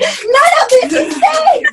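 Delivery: high, loud, half-laughing cry; a mock-desperate laughing yell at the man; laughing shout. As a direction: shout it through a burst of laughter.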